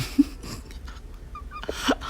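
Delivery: high squealing laugh; a giddy high-pitched laugh; laughing at the memory of the pain, high squeal. Real, not acted.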